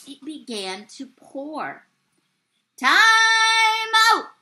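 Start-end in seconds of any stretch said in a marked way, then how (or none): 2.8-4.3 drawn-out, forceful call; umpire's yell. A narrator's reading. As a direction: call time like an umpire across the field. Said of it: "yelled the umpire".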